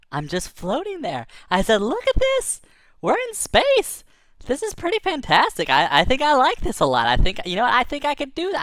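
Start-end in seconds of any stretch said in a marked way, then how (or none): 1.8-8.6 very high, squealy marveling; acted wonder, pitched way up; giddy amazement. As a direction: gush in a high, tickled voice. Storyteller marvels at floating in space.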